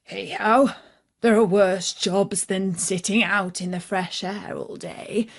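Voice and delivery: ordinary delivery, nothing marked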